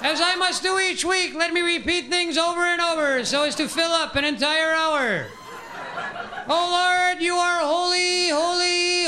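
high, sing-song froggy preaching; Kermit the Frog priest. Retold or imitated. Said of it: "Kermit the Frog priest delivery".

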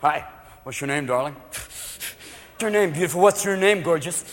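soft, slurred come-on; hushed boozy pickup attempt. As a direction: sidle up as a drunken suitor. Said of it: "'Cause men, once they drink".

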